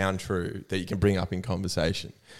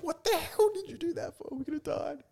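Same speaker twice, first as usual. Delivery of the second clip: much higher, softer mock shrill mum; panicked mum; 9 dB quieter than usual. Son imitates Mum scolding after the fake dog, high mock panic. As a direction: squeak out a frightened mother's scolding. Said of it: "She's like".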